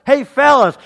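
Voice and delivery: hearty long-distance hail; calling out across the water. Retold or imitated. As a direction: shout out to men far off in a boat. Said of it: "he yelled out to the guys".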